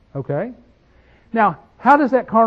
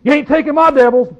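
louder, higher defiant retort; mock defiant shout; defiant possessed man; 7 semitones higher, 7 dB louder than usual. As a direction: throw it back at the preacher, defiant. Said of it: "He says".

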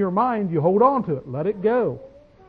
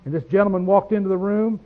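usual speaking voice

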